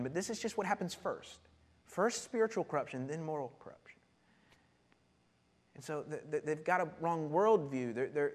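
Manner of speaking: ordinary talk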